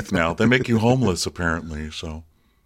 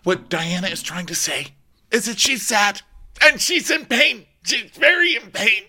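high, halting mock anguish; Rinna; 12 semitones higher, slower than usual. Rinna explaining Diana's pain, high mock-tearful voice. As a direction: build higher into a hammed-up whimper. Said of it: "And Rinna's like".

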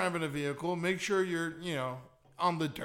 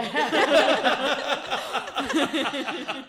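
laughing; 8 semitones higher, 9 dB louder, slower than usual. Real, not acted. Laughing at friend.

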